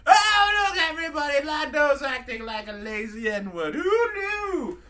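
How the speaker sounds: high, loud sarcastic announcement; drunk C-3PO. Acted. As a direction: crow mockingly in a high drunken British droid voice. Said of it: "three P O's just a mean fucking drunk".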